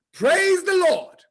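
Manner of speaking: high, slow exclamation; preacher-like proclamation. Acted. Horse farm owner teaching the command, slow and proclaiming. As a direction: proclaim it like a church cry.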